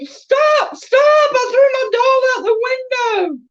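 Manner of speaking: put-on high panicked child yell; hollering child. Toddler hollering at parents to stop the car, high and urgent. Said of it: "I started hollering".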